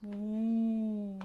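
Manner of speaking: ooh through puffed-out cheeks; puffy-cheek woo. Shown as a demonstration. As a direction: blow it out through the lips. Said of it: "blow our cheeks out".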